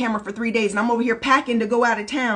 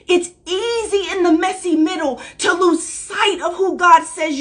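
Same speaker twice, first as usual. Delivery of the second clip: rising, louder sermon voice, higher pitch; full-force preaching; 4 semitones higher, 3 dB louder than usual. Acted. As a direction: preach it, pushing harder line by line. Preaching to the camera, building.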